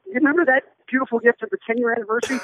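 high, quick nagging mock-wife reminder. Husband voices wife recalling anniversary gift, high and fast. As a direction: pitch up and rattle it off like a pointed wife.

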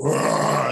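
loud growling roar; werewolf roar. Retold or imitated. Halloween werewolf greeting the kids, loud roar. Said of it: "they would come up and I'd go".

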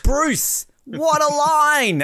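high, slow, delighted outburst; exclaiming. Real, not acted. Host marvels at the commentator's line, exclaiming. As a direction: draw it out in high-pitched amazement.

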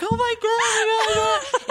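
jumping around yelling in disbelief; excited shout like a stunned friend; flipping out. Retold or imitated. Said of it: "[name] flips out".

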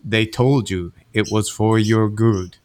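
mock a creepy conspiracy voiceover; sinister voiceover. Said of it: "the sinister inflection of".

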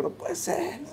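retelling his mother's reaction, a high, soft broken cry; imitating mother's disbelief. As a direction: lightly voice the mother, her voice cracking. Mother hears her son died.

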